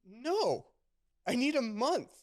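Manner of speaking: high, loud retold refusal; mock-panicked objection; incredulous protest. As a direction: protest loudly, pitch way up. Retold protest at absurd start date.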